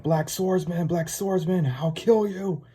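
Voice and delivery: strained, high retold yell; mock yelling. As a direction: imitate a raving villain calling out his foe. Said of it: "Zondark's just yelling out".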